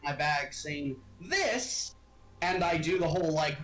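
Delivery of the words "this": showy presenter. Acted. Merchant presents the skull.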